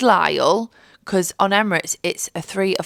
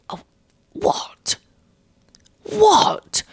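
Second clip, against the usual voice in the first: stunned disbelief; much slower than usual. Retold or imitated. Stunned by seatmate's remark.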